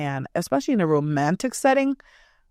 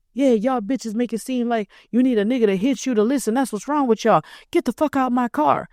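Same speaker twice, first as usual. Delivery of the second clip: higher, angry man's voice, mocked; 5 semitones higher than usual. Mocked man yelling at her to get out of his car. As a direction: spit it out like a furious guy. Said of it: "like, the man voice".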